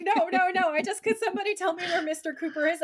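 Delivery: high, fast, flustered backpedalling; flustered pleading. Retold or imitated. Dean flustered, backpedalling to strongman.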